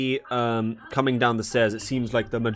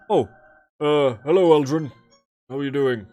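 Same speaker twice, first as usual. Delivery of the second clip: higher, louder hearty hello; hearty npc greeting; 3 semitones higher, 5 dB louder than usual. Acted. Npc greets Eldrin while patting Bob, hearty voice. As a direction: greet him like an old drinking buddy. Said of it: "he pats him on the back and goes".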